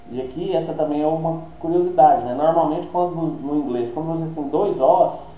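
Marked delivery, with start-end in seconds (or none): none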